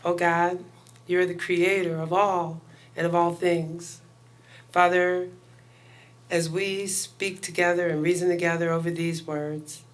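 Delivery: ordinary talk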